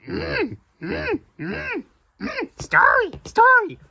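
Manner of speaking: tiny high creature voice, eager; squeaky little hums, then loud excited words; Grogu. Acted. Grogu overhearing the story, high creature voice. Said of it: "Oh, Grogu overheard".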